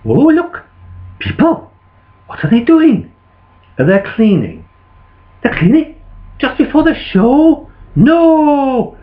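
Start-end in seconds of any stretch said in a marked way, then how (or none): 0.0-3.1 high-pitched, startled discovery; surprised dragon. Acted. Dragon spotting people in the theatre, high surprise. Puppet dragon spots cleaners. 5.4-9.0 high-pitched, incredulous outrage; indignant disbelief. Acted. Dragon hearing of cleaning before the show, indignant disbelief.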